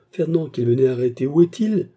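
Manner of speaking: high, loud, building question; agitated demand. A narrator's reading. Mistress asking maid where Fernand is, agitated. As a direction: demand it urgently, voice climbing. Woman frantically demands her lover.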